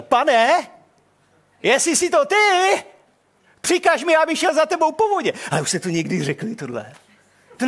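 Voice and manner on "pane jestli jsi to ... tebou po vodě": eager bold call. Acted. Peter's bold challenge retold.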